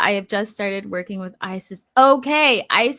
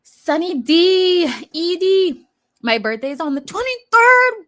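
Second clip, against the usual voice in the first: high, loud, delighted; excited; 9 semitones higher, 8 dB louder than usual. Real, not acted. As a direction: announce it with glee. Excited birthday news.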